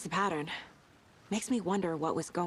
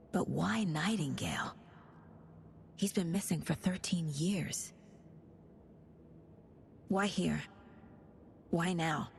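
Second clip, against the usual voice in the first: whisper; 4 dB quieter, slower than usual. Acted. Murmuring theory to herself.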